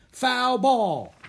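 high, loud drawn-out call; ringing shout across the field; umpire's call. A narrator's reading. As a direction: bellow it out like an umpire. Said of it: "called the umpire".

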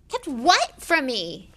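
high, loud, drawn-out eager question. A narrator's reading. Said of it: "Harry said eagerly".

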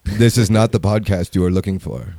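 low, mock-solemn film parody; mock mind trick. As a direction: intone it low, like casting a spell. Film line parody, mock hypnotist.

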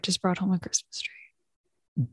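hushed secretive whisper. Real, not acted. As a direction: whisper so he can't hear. Husband may overhear.

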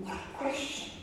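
high, whispered amazement; awed whisper. Retold or imitated. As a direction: whisper it, struck by the question. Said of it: "I sat there thinking".

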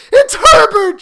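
very high, loud frantic scream; piercing cry of recognition. A narrator's reading. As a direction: shriek it as a desperate mother. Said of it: "she screamed".

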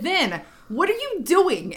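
high-pitched mock exasperation; exasperated scolding. Aunt to Vin, who keeps getting fooled, exasperated. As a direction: imitate scolding someone for being so gullible.

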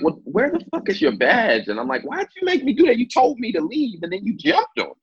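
high-pitched, hysterical protest retold; mock hysterical. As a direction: reenact frantic disbelief, voice way up high. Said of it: "kinda hysterical".

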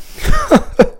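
a sudden high-pitched laugh; high squeaky giggle. Real, not acted. Laughs at own survey mistake.

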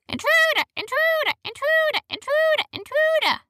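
very high squawky parrot alarm; shouting parrot. A narrator's reading. Startled parrot alerts the guards, high squawked shouting. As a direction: shriek it like a parrot, drawing out the middle. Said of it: "shouted the parrot".

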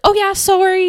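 high-pitched, hurried-to-please sorry; retold apology. Apologizing to the offended dad.

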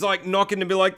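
ordinary talk

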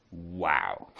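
low, hushed mock amazement; retold quiet wow. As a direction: imitate scientists marvelling in a low voice. Retold first reaction of scientists.

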